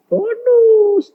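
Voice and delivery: very high, stretched BONUS; gleeful high-pitched repeat of "Bonus"; high exclamation. Real, not acted. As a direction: sing out "Bonus" high to the crowd. Repeats word for the congregation.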